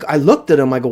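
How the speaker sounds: usual speaking voice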